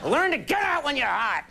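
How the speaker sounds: high-pitched mock drunk-dad holler; hammed-up, high impression of a drunk dad; drunken dad. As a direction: imitate a tipsy father hollering advice. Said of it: "what my dad used to tell me when he'd been drinking".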